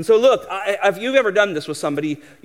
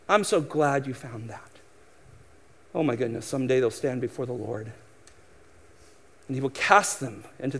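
lower, softer falsely sweet politeness; mock pious; 6 semitones lower, 6 dB quieter than usual. Preacher mimics a proud unbeliever, in mock pious politeness. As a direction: voice the proud listener's fake sincerity, dropping quieter. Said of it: "it sounds humble and it sounds pious".

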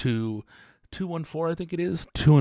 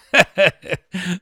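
loud high laugh; 11 semitones higher, 8 dB louder than usual. Real, not acted. Host cracking up at his friend's blunt answer, loud high laugh.

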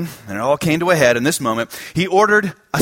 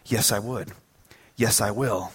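quiet surrender; 7 semitones lower, 8 dB quieter than usual. Retold or imitated. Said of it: "He said".

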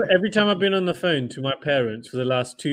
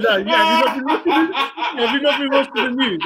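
laughing, quoting dad; 7 semitones higher, 3 dB louder than usual. Retold or imitated. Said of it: "He said".

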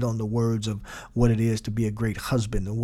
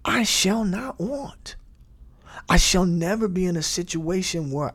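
awed revelation; 7 semitones higher than usual. Shown as a demonstration. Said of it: "now this is meditation".

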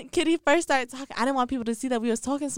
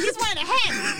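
normal talking voice

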